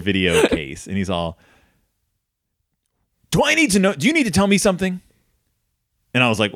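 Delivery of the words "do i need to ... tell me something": high-pitched, urgent parental interrogation; hammed-up dad confrontation, pitched high; accusing dad. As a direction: voice an alarmed dad demanding answers. Dad confronts son in retold story.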